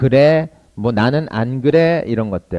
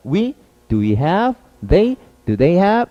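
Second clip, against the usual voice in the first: slow chunk drill; 6 semitones higher, much slower than usual. Shown as a demonstration. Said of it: "이렇게 속도감 있게 가는 것이 중요합니다".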